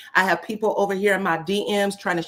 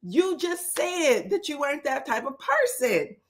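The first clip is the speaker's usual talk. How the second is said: put-on higher, disbelieving "girl" with a laugh; incredulous call-out; 5 semitones higher, slower than usual. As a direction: call her out, amused and disbelieving. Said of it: "they literally look at her like".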